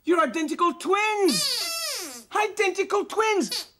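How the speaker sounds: high, drawn-out exclamation of wonder; slow, high-pitched astonishment; amazed. Acted. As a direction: marvel at an astonishing discovery. Marvels at the identical dogs.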